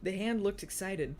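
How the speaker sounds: usual speaking voice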